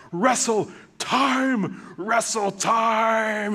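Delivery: put-on high, loud, drawn-out chant of a toddler; little boy shout. Toddler son yelling at the glass door for play, high and loud. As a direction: bang on the door and demand to play. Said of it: "and just go".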